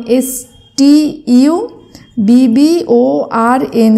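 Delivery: slow, separate letters; dictating the spelling slowly. Shown as a demonstration. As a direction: spell it out one letter at a time. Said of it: "আমি দেখো তোমাদের উচ্চারণ করে ভেঙে ভেঙে".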